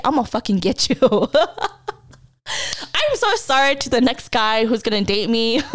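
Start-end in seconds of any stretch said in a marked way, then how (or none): 1.4-1.9 higher-pitched laugh; amused giggle after a mock threat; real laugh. Real, not acted. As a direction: laugh at your own threat.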